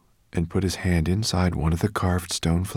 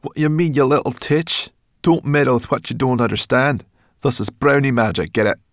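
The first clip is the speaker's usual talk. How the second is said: loud, raised, scolding creature voice; irritable brownie; 7 semitones higher, 7 dB louder than usual. A narrator's reading. Brownie warns homunculus off her magic, irritable. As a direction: tell off a meddler as a cross brownie. Sorrel snaps at Twigleg.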